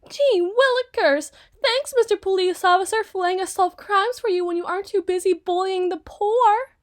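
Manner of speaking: high, loud, sarcastic goody-goody voice; mock gee-whiz kid. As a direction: parody a wide-eyed rookie, laying it on thick. Mocking the new officers' cheerfulness.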